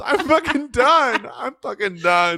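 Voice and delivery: slow, high exasperated quitting line; Shannon Bedore impression. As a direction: drag out the exit like Shannon. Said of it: "That's Shannon Bedore".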